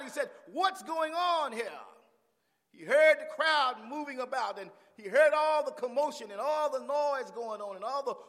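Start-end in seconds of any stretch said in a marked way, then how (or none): 0.5-1.8 high, drawn-out call; crying out. Acted. Blind beggar calling to the crowd, crying out. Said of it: "He, he cried out". 2.7-8.2 high, driving sermon delivery; full-force preaching. Real, not acted. Preacher telling of the crowd's commotion, fired up.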